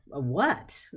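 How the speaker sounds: ordinary delivery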